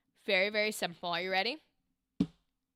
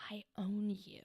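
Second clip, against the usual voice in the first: hushed, whispered, lower delivery; low-key menace demo; 4 semitones lower, 10 dB quieter than usual. Shown as a demonstration. Coach imitating the student's unchanged energy, low-key whisper. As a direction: whisper it with contained power. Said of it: "So both times it was a".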